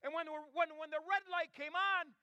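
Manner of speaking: high, emphatic storytelling climax; rousing preaching. Real, not acted. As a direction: drive the punchline home from the pulpit.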